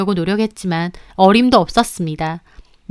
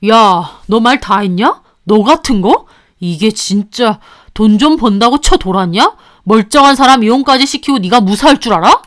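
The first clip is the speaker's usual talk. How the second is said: louder husband lashing out; angry husband; 5 dB louder than usual. Acted. Husband turns on the mistress, angry. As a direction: threaten her back.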